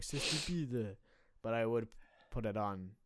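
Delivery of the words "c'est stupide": higher, hammed-up French accent; overboard French accent. Mocking how one could overdo it in an oral, exaggerated French accent. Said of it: "I wouldn't go overboard".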